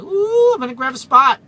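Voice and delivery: high, loud delighted ooh; excited. Retold or imitated. Trucker spotting a free parking space, thrilled and loud. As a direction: pounce on the spot with glee. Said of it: "I'm like".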